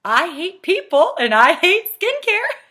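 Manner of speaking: loud, high, drawn-out mock declaration; hammed-up comic hater; joking people-hater. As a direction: exaggerate it for a laugh, slowly and loudly. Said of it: "I make light of it".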